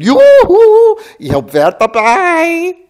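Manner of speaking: very high, loud call, slowing at the end; excited yoo-hoo. A narrator's reading. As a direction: shout it gleefully across the valley. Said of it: "winkte die Trachtenträchtige".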